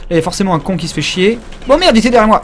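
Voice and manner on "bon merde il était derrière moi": louder, higher burst of surprise; startled cursing. Real, not acted. Player caught from behind by an enemy, startled outburst. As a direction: blurt it out as if ambushed.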